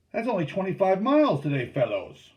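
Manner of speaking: high, loud, quick call to the crew; rally the paddlers loudly; steersman calling out. A narrator's reading. Steersman teases his tired crew, calling out. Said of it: "the steersman said".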